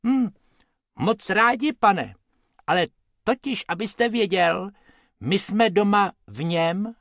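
sweet, eager little rabbit explaining; small rabbit talking politely. A narrator's reading. Rabbit Bobek's voice.

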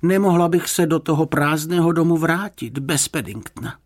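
high-pitched, insistent refusal; determined. A narrator's reading. Woman refusing to go home alone, determined. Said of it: "prohlásila odhodlaně".

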